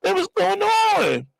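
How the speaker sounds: very high, drawn-out mock bewilderment; baffled passenger. Passenger in back of cab, high bewildered wail. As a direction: wail the question slowly, way up high. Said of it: "I'm in the back of the cab".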